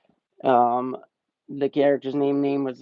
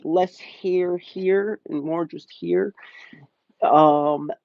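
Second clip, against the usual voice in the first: normal talking voice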